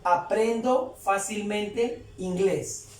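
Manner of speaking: slow, drawn-out words with rising ends; slow translation. Shown as a demonstration. Teacher translating for learners, slowly word by word. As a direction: stretch each word for learners.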